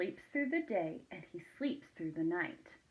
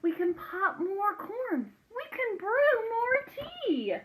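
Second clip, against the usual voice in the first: high, soothing little mouse squeak; squeaky mouse; 11 semitones higher, 4 dB louder than usual. Acted. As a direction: comfort the bear like a tiny mouse. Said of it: "Mouse squeaks".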